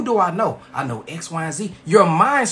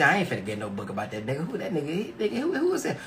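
skeptical reader voice; 3 semitones lower, 7 dB quieter, faster than usual. Retold or imitated. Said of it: "some of y'all may think".

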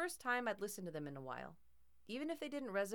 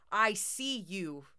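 drawn-out, louder menace; slow eerie threat; 3 semitones higher, 9 dB louder, much slower than usual. A narrator's reading. Bear warns the intruder, slow eerie threat. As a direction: let every word hang like a threat. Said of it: "Fran said in Grandpa's voice".

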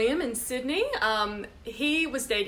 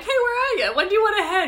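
laughing mock call; 11 semitones higher, 7 dB louder, much faster than usual. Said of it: "I'm like".

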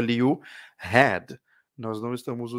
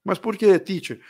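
higher, louder surprised student asking why; mock student question; 7 semitones higher, 6 dB louder than usual. Teacher playing a student asking her why, surprised. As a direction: burst in like a puzzled student.

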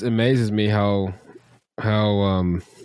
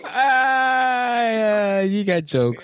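long high drawn-out "ah"; high wail sliding down into banter; mock-offended comeback; 11 semitones higher, much slower than usual. Real, not acted. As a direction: take the ribbing with a high, stretched cry. Mock reaction to being teased.

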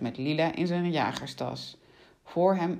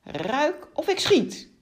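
slow, high, louder threat; acted menace, raised and drawn out; threatening; 10 semitones higher, 5 dB louder, slower than usual. A narrator's reading. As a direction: draw out the command as an ultimatum. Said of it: "Met een dreigend gezicht".

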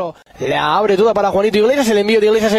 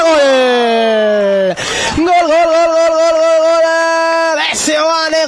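breakneck goal commentary; 4 semitones higher, much slower than usual. Real, not acted. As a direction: scream the goal, stretching it.

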